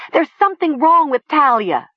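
high, loud alarm; frantic shout. A narrator's reading. Nessa alerts Seth about Talia, shouting frantically. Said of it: "Nessa shouted".

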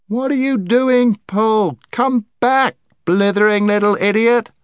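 high, loud alarmed schoolboy shout. A narrator's reading. Scrub calling Jill back from the cliff edge, shouting. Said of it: "shouted Scrub".

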